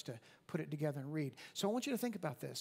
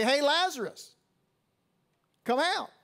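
calling out high and loud into the tomb; 10 semitones higher, 10 dB louder than usual. Acted. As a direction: summon him out with a big ringing call. Jesus calls at the tomb.

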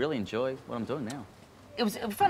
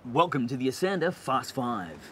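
mock suspense announcer voice; lightly dramatic quiz intro; tense announcer; 2 semitones higher than usual. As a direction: build tension like a quiz host. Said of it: "get the tension mood".

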